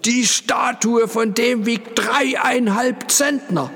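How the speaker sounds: very high, slow strained shout; outraged yell. A narrator's reading. Porter warning about the heavy statue, yelling. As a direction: bellow each word at the top of the range. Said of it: "schrie der Pförtner".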